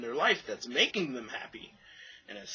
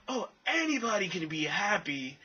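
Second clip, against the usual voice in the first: high mocking sing-song; 6 semitones higher than usual. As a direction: mimic them as smug and clueless. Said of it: "they say dumb things like".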